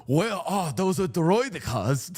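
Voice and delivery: Star Wars Neimoidian accent, slow and exaggerated; slow, comic Neimoidian accent. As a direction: put on a hammy Neimoidian accent. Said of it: "it's a Nemodian accent".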